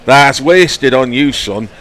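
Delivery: put-on a lightly done Yorkshire accent, slow on "wasted"; imitating Fred Trueman in a half-hearted Yorkshire accent. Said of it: "I can't do a, a Yorkshire accent".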